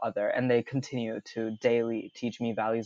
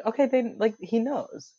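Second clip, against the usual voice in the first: higher, a dawning "he knows"; mock-shocked inner thought, pitched up; stunned realization; 10 semitones higher than usual. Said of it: "I was like".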